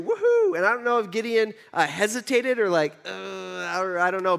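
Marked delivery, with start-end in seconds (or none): 0.0-0.6 high, loud celebratory woohoo; a high joyful whoop; excited whoop. Real, not acted. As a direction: whoop like you just heard good news. Celebrating God's promise of victory.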